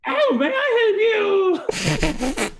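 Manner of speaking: very high, slow raptor screech; velociraptor speaking English. Acted. Raptor creature greeting the visitors reverently, velociraptor screech. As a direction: squawk the words out like a raptor straining at English. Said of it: "like a, a velociraptor trying to speak English".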